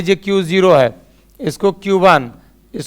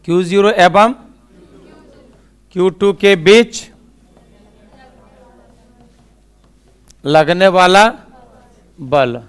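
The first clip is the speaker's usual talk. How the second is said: slow, word by word, with long pauses; slow dictation. Shown as a demonstration. Teacher dictating to students, slow and word by word. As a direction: spell it out slowly so students can write.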